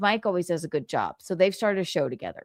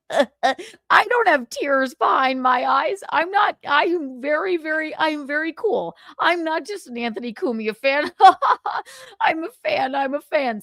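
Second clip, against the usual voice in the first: Carl impression; 8 semitones higher, 3 dB louder than usual. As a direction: play him insecure and pitched up. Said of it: "Here's my Carl impression".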